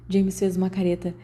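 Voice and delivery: plain reading voice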